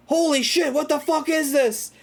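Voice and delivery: high-pitched panicked yell, imitated; mock screaming. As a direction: play a man shrieking in horror. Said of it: "like, screaming".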